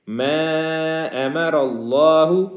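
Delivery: مَا held long; lengthened vowel on مَا. Shown as a demonstration. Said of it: "ایک سے تین گنا لمبا کریں گے".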